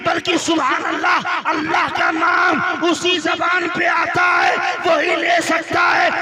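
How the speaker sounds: booming high shouted sermon; fiery preaching. Acted. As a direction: preach it at full force, slowly at times. Preaching at full force.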